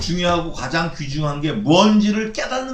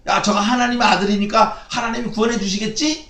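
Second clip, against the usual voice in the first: a hint of a sneering taunt, pitched up; mocking taunt; 7 semitones higher, 3 dB louder than usual. Preacher imitates onlookers taunting the crucified Jesus, light mock taunt. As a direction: lightly taunt the man on the cross. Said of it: "이렇게".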